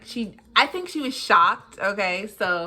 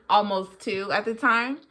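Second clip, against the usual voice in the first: ordinary delivery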